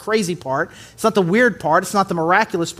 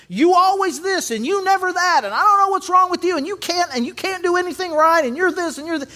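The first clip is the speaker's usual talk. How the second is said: high, rapid-fire mock complaint; mock nagging spouse; 9 semitones higher than usual. Spouse scolding you, in a high mock rant. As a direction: rattle off a spouse's accusations, pitched up. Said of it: "comes at you just kind of with irrational expectations".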